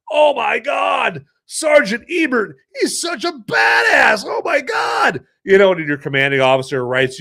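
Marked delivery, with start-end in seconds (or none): 0.1-5.2 awestruck onlookers. Retold or imitated. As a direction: cry out slowly in disbelief. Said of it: "they're like".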